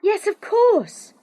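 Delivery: calling after. Acted. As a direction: call after him across the valley. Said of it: "I called after him".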